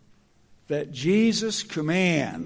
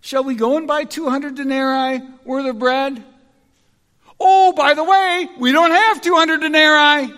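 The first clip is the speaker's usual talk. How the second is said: loud, high mock sarcasm; respectful but sarcastic retort; sarcastic disciples; 9 semitones higher, 10 dB louder than usual. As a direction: throw the question back sarcastically. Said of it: "this is said sarcastically".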